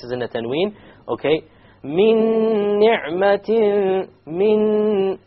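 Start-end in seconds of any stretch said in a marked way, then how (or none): 1.8-5.2 min ni'matin recited high and very slow, noon merged with a gunna; tajweed recitation demo. Shown as a demonstration. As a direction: draw out min ni'matin in chant. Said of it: "you have a noon followed by".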